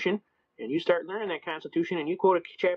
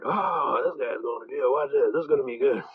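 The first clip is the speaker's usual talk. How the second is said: onlookers' reaction; 2 semitones lower than usual. Retold or imitated. People in the court watching him, mock groaning commentary. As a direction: mimic spectators groaning and relishing trouble.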